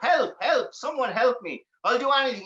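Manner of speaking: high, pleading cry; begging for help. Acted. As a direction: plead desperately to be let out. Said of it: "begging for help".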